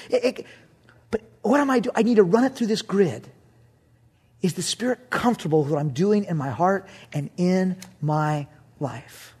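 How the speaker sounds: normal talking voice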